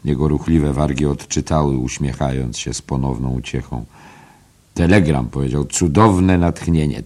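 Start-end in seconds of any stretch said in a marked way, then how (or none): 4.7-5.3 louder, brash announcement; "Telegram" called out loud and bright; Mulligan announcing. Acted. 5.7-7.0 slow, raised mock-inspired exclamation; a hammed-up "cudowne natchnienie"; mock rapture. Mulligan exults over telegram.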